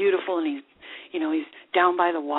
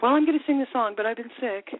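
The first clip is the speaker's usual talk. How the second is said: mock singer excuse; 4 semitones higher than usual. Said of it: "You know they do".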